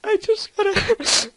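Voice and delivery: high, slow mock-tearful whine; fake crying. Gamer explaining the crash, pretend crying. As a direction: break off as if about to sob. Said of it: "I'm not actually crying".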